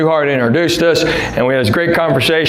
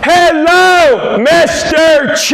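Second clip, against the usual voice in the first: high, loud, slow drawn-out greeting; an overloud, stretched-out hello; 12 semitones higher, 4 dB louder, much slower than usual. Retold or imitated. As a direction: call it out big and slow like he can't hear. Mother-in-law greets dad loudly.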